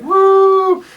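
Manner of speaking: high, loud whoop; long cheering "woo"; crowd whoop. Acted. Tavern crowd welcoming a regular, high loud whoop. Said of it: "Everybody's like".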